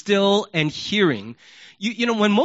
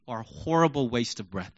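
ordinary talk, nothing marked

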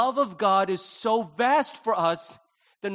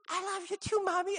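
put-on little child; 13 semitones higher, 4 dB quieter than usual. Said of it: "they go".

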